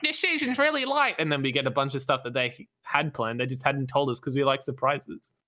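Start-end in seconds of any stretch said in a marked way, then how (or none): 0.0-1.1 high-pitched mocking voice; whiny complainers. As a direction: mimic the grumbling crowd up high. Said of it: "Then everyone's like".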